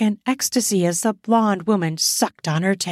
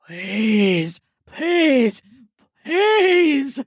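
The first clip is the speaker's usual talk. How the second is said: pleading, building; 4 semitones higher, much slower than usual. Acted. Charles begs the women around him, desperate pleading building up. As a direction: beg harder with every please. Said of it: "he pleaded".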